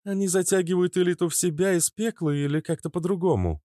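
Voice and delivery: lightly voiced young woman. A narrator's reading. Narrator voices Natasha.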